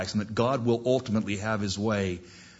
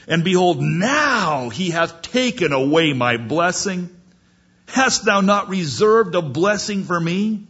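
loud, high acted protest; indignant plea; 6 semitones higher, 5 dB louder than usual. Esau pleading with his father for a blessing, indignant. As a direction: push it out as an aggrieved appeal.

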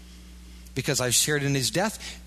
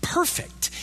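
"perfect." lifted high on an airy breath; an awed, high, airy "perfect."; high breathy echo; 13 semitones higher than usual. Acted. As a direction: float "perfect." up high and unvoiced. Repeated attribute, high.

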